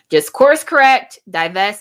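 punchy advice shouted at the camera; emphatic call. Real, not acted. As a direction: really drive the point home. Urging viewers to leave bad men.

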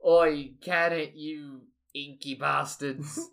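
loud call, then slow drawn-out insult; Shark. Acted. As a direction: open loud, drag out the insult. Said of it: "And Shark is just like".

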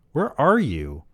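high-pitched whiny question; whining. A narrator's reading. Man on the phone looking for his friend, whining. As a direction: whine it, pitch swooping down at the end. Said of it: "I whined".

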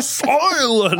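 very high, stretched-out bellow; mock lion yell. Diner silencing waiter over tacos, mock yelling. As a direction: cut them off with a theatrical roar. Acted restaurant quarrel.

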